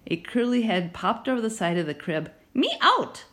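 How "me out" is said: put-on high squeaky toddler voice; toddler demand. A narrator's reading. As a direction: demand it like a two-year-old.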